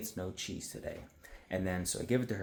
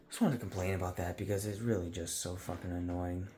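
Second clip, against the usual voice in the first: usual speaking voice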